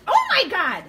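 loud, high cry of alarm; startled scream. Real, not acted. Woman by the donut box, screaming in fright. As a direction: shriek it in sudden fright.

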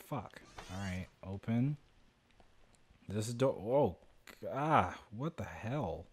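normal talking voice

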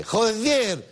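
"Joder" with a high, stretched E in "-der"; accent on the E. Shown as a demonstration. Comic showing how to cap the oath, high stressed E in "Joder". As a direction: lean hard on the final E of "Joder". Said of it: "así con acento en la E".

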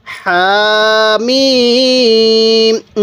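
very slow, with the vowels held long; elongated recitation. Shown as a demonstration. Teacher showing viewers how to elongate, held long. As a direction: sing out Hamim with the long madd. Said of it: "মিমের মধ্যে বেশি টান দিব".

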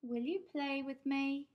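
high little cat voice; cat meow voice. Acted. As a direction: plead like a small kitten. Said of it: "Pumpkin meowed to Captain".

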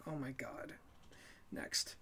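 exasperated whisper; whispered oh my God, next. Real, not acted. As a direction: breathe it out under the breath. Weary aside.

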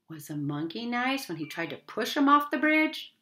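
plain reading voice

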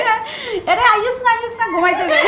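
high, playful call to come to bed; mock motherly coaxing in Noakhali dialect; imitating her mother. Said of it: "আমার আম্মু বলে". (আম্মুর নকল করে মজার সুরে ডাকা)

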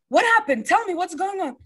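high, urgent retold demand; imitated alarmed dad. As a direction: imitate a father demanding news. Retold dad's alarmed questioning.

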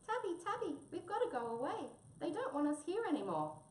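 very high-pitched, urgent mouse voice; upset little mouse. Acted. Mouse tells Tabby they must leave, upset. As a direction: plead as a tiny, distressed mouse.

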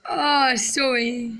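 normal talking voice

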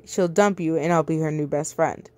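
ordinary delivery, nothing marked

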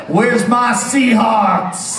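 long, drawn-out stadium yell of "Seahawks"; rallying shout. Acted. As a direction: hype the home crowd like a game-day announcer. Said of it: "That's better".

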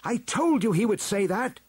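high, insistent retort; indignant double. A narrator's reading. Double argues before the elders.

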